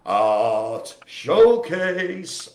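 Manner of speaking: loud, hugely drawn-out title call; segment announcement. Pretend. Host introducing the art segment, loud drawn-out announcement. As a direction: stretch each word like a showy announcer.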